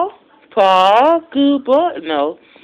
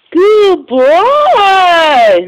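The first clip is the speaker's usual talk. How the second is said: higher, louder burst of praise; excited praise; 7 semitones higher, 8 dB louder than usual. Real, not acted. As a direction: cheer the dog on. Praising the dog.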